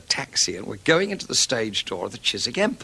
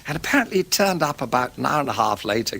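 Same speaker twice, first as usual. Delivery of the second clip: ordinary delivery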